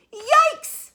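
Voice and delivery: shrieking, very high and loud; yelled yikes. Acted. Mother hearing the worst, yelling in alarm. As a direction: yelp in alarm. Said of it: "yelled her mother".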